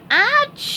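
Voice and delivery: very high loud achoo; giant sneeze. Acted. SpongeBob sneezes at the Krusty Krab, giant sneeze. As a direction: blast out a huge sneeze. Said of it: "sneezed a giant sneeze".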